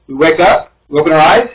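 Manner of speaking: usual speaking voice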